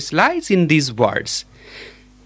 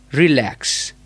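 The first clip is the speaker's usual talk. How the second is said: "relax" with the second syllable stressed; stress on LAX in "relax"; 3 semitones lower than usual. Shown as a demonstration. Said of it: "The stress lies in the lax".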